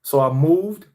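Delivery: normal talking voice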